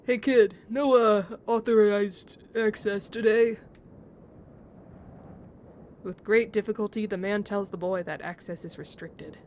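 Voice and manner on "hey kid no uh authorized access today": loud, slow, slightly British watchman; a British guard, loud and slow; slightly British accent. Pretend. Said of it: "slightly British".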